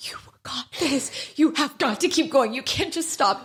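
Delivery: coaching herself through tears, lightly acted; mock pep talk. Stylist in the salon mirror, mock self-encouragement. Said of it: "look at myself in the mirror and be like".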